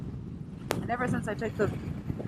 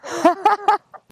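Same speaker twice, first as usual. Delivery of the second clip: loud laugh; 12 semitones higher, 6 dB louder than usual. Real, not acted. Laughing about Hooters.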